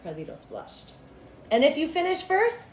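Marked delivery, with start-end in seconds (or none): 1.5-2.6 higher, louder mother's voice; voiced mother. Mother telling her son where she'll be, high and bright. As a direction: tell your son the plan brightly.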